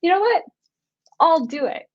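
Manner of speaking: high, loud burst of resolve; a sudden high, loud "I'll do it"; bold decision. Retold or imitated. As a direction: snap into a fearless yes. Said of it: "and I was like".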